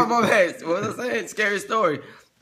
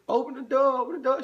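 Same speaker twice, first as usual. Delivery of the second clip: high, pounding on the door yelling open up; desperate high-pitched plea at the door; frantic pleading; 11 semitones higher than usual. Retold or imitated. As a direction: beg her frantically to open up. Begging woman to open door.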